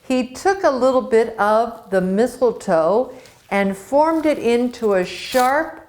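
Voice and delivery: ordinary delivery, nothing marked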